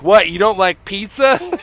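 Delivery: mock-incredulous comeback, high and loud; Adam. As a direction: imitate a friend acting baffled by rejection. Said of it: "And he'd be like".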